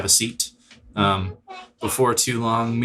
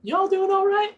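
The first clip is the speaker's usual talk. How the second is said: much higher, chirpy innkeeper; high barmaid voice. Acted. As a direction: greet guests as a bubbly barmaid. Innkeeper Mina greets guests.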